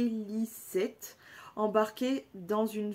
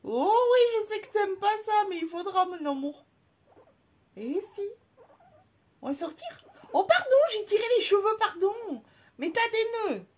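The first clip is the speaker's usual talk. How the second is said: squeaky, much higher and louder pet talk; 11 semitones higher, 6 dB louder than usual. Real, not acted. Owner cooing to her angry pet, high baby voice.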